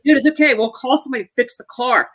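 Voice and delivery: high-pitched put-on dude voice; imitated movie dialogue. Acts out character after car crash.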